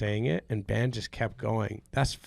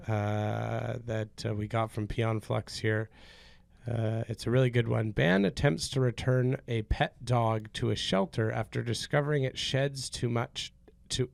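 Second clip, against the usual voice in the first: normal talking voice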